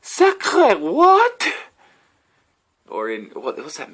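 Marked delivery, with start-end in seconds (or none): 0.0-1.5 much higher and louder, baffled whining; clueless tourist. Pretend. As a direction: play an indignant English-only tourist in France. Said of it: "me going to France and being like".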